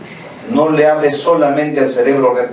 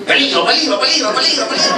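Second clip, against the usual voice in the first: high, repeating warning siren; mind's alarm; 11 semitones higher, faster than usual. Acted. Child's mind as she wants to cross, high-pitched alarm. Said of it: "su mente va a decir".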